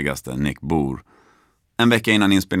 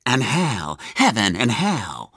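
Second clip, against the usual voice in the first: higher, slow, theatrical doom; ominous pronouncement; 3 semitones higher, slower than usual. Retold or imitated. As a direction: intone it like a dark revelation. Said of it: "och säger". (långsamt, teatraliskt och olycksbådande)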